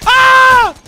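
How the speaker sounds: sharp, high, loud scream of pain. Real, not acted. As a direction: scream as the bullets hit. Hit by gunfire.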